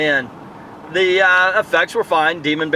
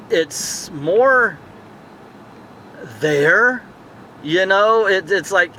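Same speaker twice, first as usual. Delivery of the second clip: normal talking voice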